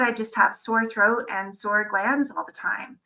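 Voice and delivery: ordinary talk, nothing marked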